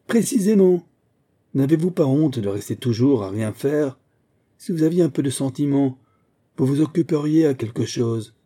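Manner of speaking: scolding. Acted. Governess scolds idle pupil.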